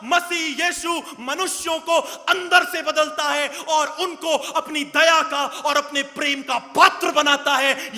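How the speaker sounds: fervent sermon climax; preaching at full force. Acted. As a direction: build it up and proclaim to the congregation.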